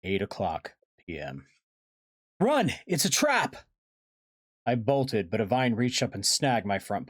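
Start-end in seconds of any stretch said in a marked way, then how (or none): 2.4-3.5 high, loud alarm cry; shouted warning. Acted. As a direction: shout it to get them running. Warning shouted amid the ambush.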